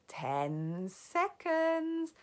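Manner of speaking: very slow, drawn-out witch call; witch crying out. Acted. Grand High Witch counting down, very slow. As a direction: stretch it out. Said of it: "cried the Grand High Witch".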